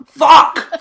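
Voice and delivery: sudden loud yell with a laugh; pained yell. Retold or imitated. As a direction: scream it like a javelin hit you. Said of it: "But in a more Russian accent".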